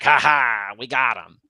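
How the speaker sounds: loud villainous "ha ha" boast; mock gloating. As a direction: act out a gleeful villain's triumph, loudly. Gloating villain, contrasted with remorseful one.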